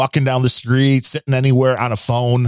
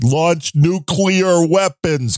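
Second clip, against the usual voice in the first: very slow toy-computer voice; Speak and Spell voice; 2 semitones higher, much slower than usual. Pretend. Host mocks Joe Biden pounding a toy, slow machine voice. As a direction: talk like a spelling toy, word by word. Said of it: "with, like, a speak and spell".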